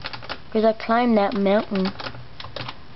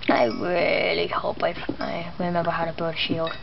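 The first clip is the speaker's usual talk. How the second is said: whispering; 5 semitones lower, 5 dB quieter than usual. Real, not acted. Quiet aside while mining.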